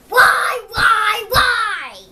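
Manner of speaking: loud, high wailing why, why, why; anguished cry. Acted. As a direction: wail it out like a begging pigeon. Pigeon crying out in protest.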